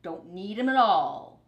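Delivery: loud stubborn grumble; grumbling. Acted. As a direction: insist a bit too hard. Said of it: "grumbled Rat".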